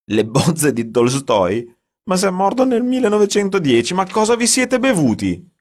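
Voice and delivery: high, loud disbelief; shrill-pitched mocking disbelief, loud; incredulous outburst. A narrator's reading. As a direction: scoff at the absurd claim.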